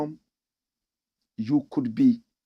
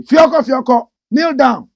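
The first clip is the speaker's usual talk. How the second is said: loud, high barking order; bullying command; 10 semitones higher, 13 dB louder than usual. Retold or imitated. Senior bullying a first-year student, loud barking order. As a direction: bark it at a junior.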